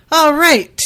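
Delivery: loud, high lecturing opener; firm, didactic. A narrator's reading. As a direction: call it out like an instructor taking charge. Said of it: "he said in a firm, didactic tone".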